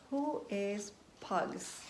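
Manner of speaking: softly drawn out, curious; slow wondering question. Acted. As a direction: ask the children slowly.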